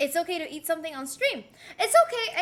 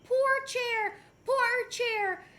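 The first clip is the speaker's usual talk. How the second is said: lightly high, slow mock pity; a hint of fake sympathy for the chair; mock-sad poor chair; mocking viewers; 7 semitones higher, much slower than usual. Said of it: "you guys can't say".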